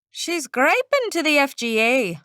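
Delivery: small male voice, Irish lilt; a little Irishman with a lilt; Irish accent. Acted. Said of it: "a small male voice said in an Irish accent".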